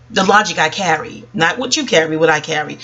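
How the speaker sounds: ordinary delivery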